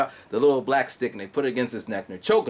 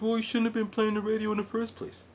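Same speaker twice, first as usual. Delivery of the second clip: 1 mock reply; 10 semitones higher, 4 dB quieter than usual. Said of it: "I was like".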